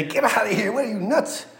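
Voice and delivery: high, scoffing dismissal; mock brush-off. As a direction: play a scoffing man waving her off. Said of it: "He didn't say".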